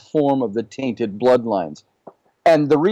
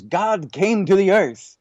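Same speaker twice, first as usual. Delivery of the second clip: Richard Feynman; 6 semitones higher, slower than usual. Retold or imitated.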